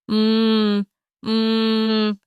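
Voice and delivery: gagged, strained moaning from inside a sack; muffled moan. Acted. Gagged grandmother moaning from inside the sack, muffled and strained. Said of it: "May mahinang ungol na nanggagaling sa loob".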